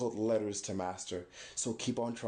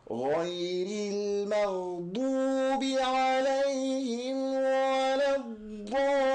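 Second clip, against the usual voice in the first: Quran recitation; 12 semitones higher than usual. Shown as a demonstration. Teacher chanting Fatiha for students to copy. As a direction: recite it like in prayer, rising high. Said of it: "Quran recitation demo".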